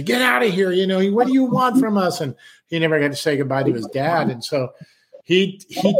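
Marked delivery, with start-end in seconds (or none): 0.0-2.2 sharp, higher mock outburst; angry. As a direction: shout it at him, outraged. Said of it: "She went crazy".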